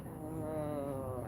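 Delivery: ordinary talk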